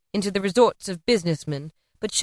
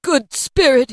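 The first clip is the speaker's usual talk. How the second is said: higher, louder begging; pleading cry; 7 semitones higher, 8 dB louder, slower than usual. A narrator's reading. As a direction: fall down and beg. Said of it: "he pursued".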